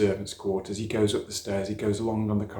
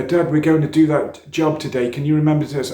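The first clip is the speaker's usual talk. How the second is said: put-on higher, louder keen kid; eager child; 6 semitones higher, 8 dB louder than usual. Son reminding dad about today's job, eager and loud.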